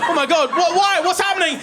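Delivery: high, frantic questions; panicked son. Acted. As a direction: act out rising alarm at mum's message. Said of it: "put the fear of God into my heart".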